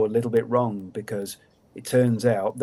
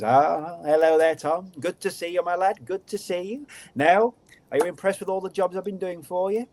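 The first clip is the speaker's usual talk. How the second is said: higher, sly goblin greeting; sly creature voice; 8 semitones higher than usual. Acted. Creature greets Tom, higher smug goblin voice. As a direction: lay on the little creature's smug charm.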